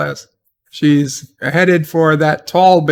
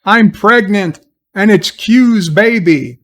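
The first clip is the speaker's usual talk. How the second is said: mock shocked announcement, raised pitch; slow, high, hammed-up revelation; Rene Picard; 5 semitones higher, 5 dB louder than usual. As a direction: blurt out a fake soap-opera bombshell, drawn out. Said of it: "Rene Picard could say".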